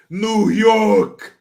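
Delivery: loud, high New York shout-out; big put-on New York accent. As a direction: holler it like a Brooklyn local. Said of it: "give us your New York accent".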